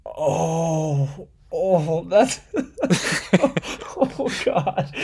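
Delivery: laughing, touched. Real, not acted. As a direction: break into delighted laughter. Touched by child's question.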